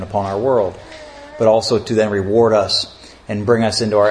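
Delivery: low, measured prayer voice; opening prayer. Real, not acted. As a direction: lead the congregation reverently in prayer, pitched low.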